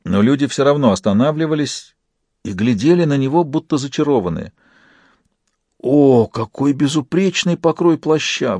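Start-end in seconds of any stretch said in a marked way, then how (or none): 5.8-8.6 high, slow, swooning admiration; admiring passer-by. A narrator's reading. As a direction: gush over the coat. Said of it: "восхитился один из прохожих".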